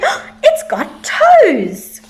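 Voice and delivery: slow, laughing cry of discovery; laughing exclaim. A narrator's reading. Giraffe laughing as she finds the toes. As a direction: laugh the words out with delight. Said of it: "She started to laugh".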